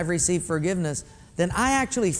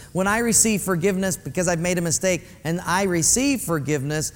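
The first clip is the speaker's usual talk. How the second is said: usual speaking voice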